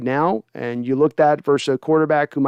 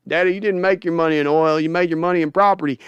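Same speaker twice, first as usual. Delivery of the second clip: Steven voice. Acted. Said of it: "more Jerry and Steven".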